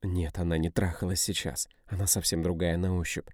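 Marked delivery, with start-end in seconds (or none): none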